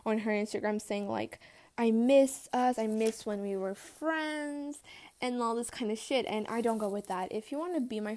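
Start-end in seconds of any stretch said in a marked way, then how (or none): 1.8-4.8 slow, drawn-out mock pining; mock dramatic. Mimicking friend's social media story, slow and mock dramatic. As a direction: drag it out like a melodramatic post. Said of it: "posted a fucking dramatic story".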